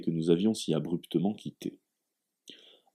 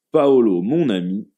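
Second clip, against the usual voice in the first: hearty hail; 3 semitones higher, 7 dB louder than usual. A narrator's reading. Massimo hails his friend.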